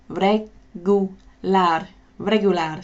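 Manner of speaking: regular slowly with a rolled Spanish R, "re-goo-LAR"; Spanish accent. Shown as a demonstration. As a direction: regular in a Spanish accent, stress on the last syllable. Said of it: "a Spanish accent".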